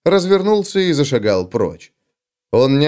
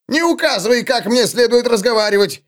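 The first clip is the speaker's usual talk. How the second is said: high, shrill-free but sharp outburst; yelling back in offence; mock yell; 10 semitones higher than usual. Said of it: "завопил гном". (гном вопит, высоким голосом)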